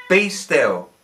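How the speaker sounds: loud, firm command; commanding shout. A narrator's reading. Jesus commanding the waves, loud and firm.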